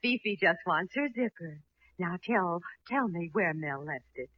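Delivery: seductive, hushed persuasion; coaxing. Acted. Coaxing the shy assistant.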